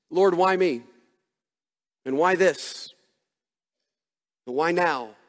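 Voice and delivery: slow, spaced-out plea to God. Retold or imitated. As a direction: imitate an anguished prayer, pausing after each question. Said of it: "maybe a little bit more intense".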